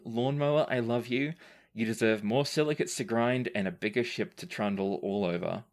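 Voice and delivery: usual speaking voice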